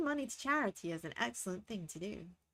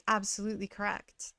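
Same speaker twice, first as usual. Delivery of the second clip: ordinary talk, nothing marked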